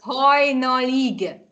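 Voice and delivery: "Hajnalig" stretched out slowly; teacher's drawn-out "Hajnalig"; slow model word. Shown as a demonstration. Teacher answers how word is said.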